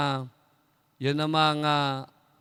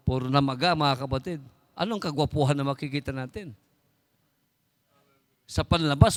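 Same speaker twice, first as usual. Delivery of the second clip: ordinary talk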